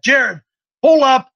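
calling out. Real, not acted. Host tells producer to put something up, calling out. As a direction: call it out like a command.

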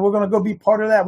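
normal talking voice

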